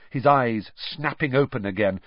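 ordinary delivery, nothing marked

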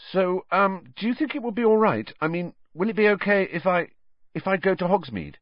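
high, halting, nervous request; hesitant pleading. A narrator's reading. Harry asking his teacher for permission, nervously hopeful.

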